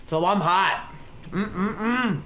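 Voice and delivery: talking through a mouthful of popcorn; chewing while talking; mouth full. Real, not acted. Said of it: "talking with my mouth full".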